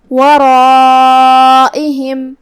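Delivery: ورائہم with the long a drawn out four beats; four-count madd. Shown as a demonstration. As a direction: stretch the alif, heavy ra. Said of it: "چار مد کے برابر لمبی کریں گے".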